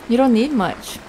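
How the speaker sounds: ordinary delivery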